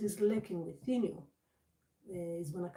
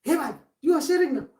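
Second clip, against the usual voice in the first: loud, higher-pitched outburst; loud, high acted exclamation; mock talking style; 7 semitones higher, 12 dB louder than usual. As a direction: call out to a buddy with raised voice. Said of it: "You start talking like that".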